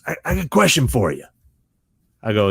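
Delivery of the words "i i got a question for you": high, quick actor impression; imitated actor, raised and hurried; Robert Patrick. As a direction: fire it off as the actor. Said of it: "And he goes".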